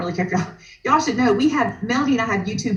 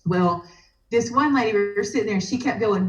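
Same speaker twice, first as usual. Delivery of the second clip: ordinary delivery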